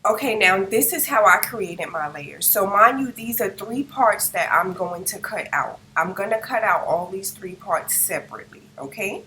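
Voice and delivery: usual speaking voice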